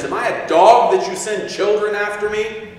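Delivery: Goliath mocking the Israelites, angry and loud; angry giant. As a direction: roar an insulted taunt. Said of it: "Goliath is angry".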